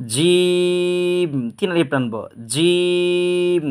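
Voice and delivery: জিম held very long and high; drawn-out jim. Shown as a demonstration. Teacher to students, a long held জিম. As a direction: stretch জিম over three beats, very slowly. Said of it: "তিন আলিফ টেনে পড়ব".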